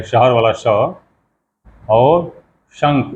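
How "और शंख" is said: शंख drawn out slowly, श clear and nasal before ख; teacherly slow शंख; slow demo of शंख. Shown as a demonstration. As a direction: sound out शंख syllable by syllable. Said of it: "उसके ऊपर बिंदी होती है".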